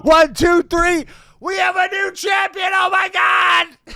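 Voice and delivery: high, loud call of the pinfall; shouting in real excitement; excited shout. Real, not acted. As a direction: call the win like a thrilled fan. Reliving the title win.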